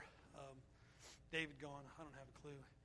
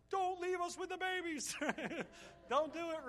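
mock pleading; 10 semitones higher than usual. Real, not acted. Joking plea to departing colleague.

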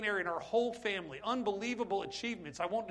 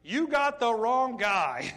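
loud, slow, pleading refusal; reluctant protest; 3 semitones higher, 8 dB louder, slower than usual. Retold or imitated. Moses refusing God, loud and pleading. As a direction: push the job away, loud and slow. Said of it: "how Moses responded".

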